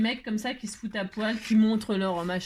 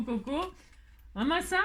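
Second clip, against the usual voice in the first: usual speaking voice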